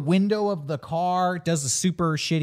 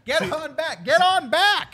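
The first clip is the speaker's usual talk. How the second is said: high, rising cop holler; hammed-up high-pitched order; mock cop commands; 10 semitones higher, 4 dB louder than usual. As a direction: imitate a cop yelling at a crowd. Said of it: "most real cop moment".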